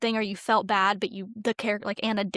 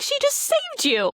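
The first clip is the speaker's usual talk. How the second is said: high outraged exclamation at the screen; retold protest, voice jumping up in disbelief; indignant outburst; 3 dB louder than usual. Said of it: "it made me mad".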